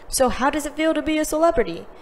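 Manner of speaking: a reporter bends down to quiz Emma; reporter's voice. Acted. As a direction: rattle it off like an eager journalist. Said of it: "a newspaper reporter bent down to ask".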